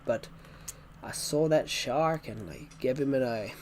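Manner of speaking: hammed-up Australian accent, like a shark-story teller. Shown as a demonstration. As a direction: do a jokey Australian bloke. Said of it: "The Australian accent".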